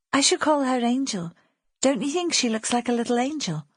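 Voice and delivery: a hint of a gentle young girl, hopeful; young girl May. Girl May naming the foundling baby, light and gentle. May's voice in the story.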